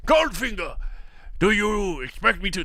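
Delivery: bootleg Scottish film-star voice; Sean Connery. Retold or imitated. Co-host re-enacting the laser scene, bootleg Connery impression. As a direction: do a rough knock-off of a suave screen spy. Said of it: "That's my bootleg Sean Connery voice".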